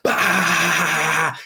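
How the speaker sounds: high blurted mock gunfire "bah"; gunfire sound. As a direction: blurt it like a burst of shots. Imitating guns mowing down jungle.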